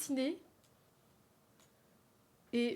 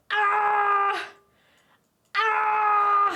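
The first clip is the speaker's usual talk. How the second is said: cartoon scream; 8 semitones higher, 9 dB louder than usual. Voicing the drawn man under attack.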